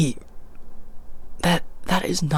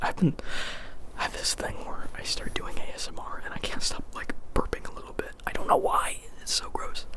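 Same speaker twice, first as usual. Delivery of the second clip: whispering; 8 dB quieter than usual. Real, not acted. Whispered confession about burping.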